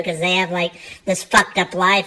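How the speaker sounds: ordinary delivery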